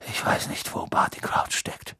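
hushed unvoiced whisper. A narrator's reading. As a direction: whisper it gravely. Dumbledore speaks hushed.

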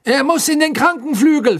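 high, loud official proclaiming; agitated shout, pitched high; loud announcement. A narrator's reading. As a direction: announce it loudly and urgently. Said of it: "verkündete Fudge laut".

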